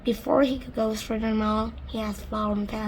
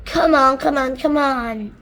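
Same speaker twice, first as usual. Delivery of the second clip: loud impatient yell; shouted; 3 semitones higher, 9 dB louder than usual. Acted. Centipede shouting impatiently at the glowworm, loud. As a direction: hurry her up, loudly. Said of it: "shouted the centipede".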